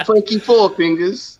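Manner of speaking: high mock British film-gangster accent. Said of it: "perfect my British accent".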